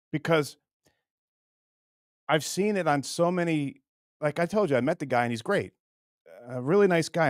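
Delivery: ordinary talk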